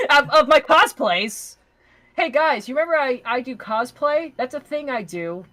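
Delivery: oversell it to the viewers; cheesy promo voice; hammed-up shill.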